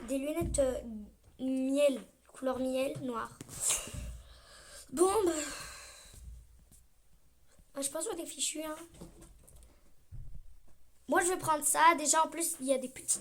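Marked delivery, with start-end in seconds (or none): none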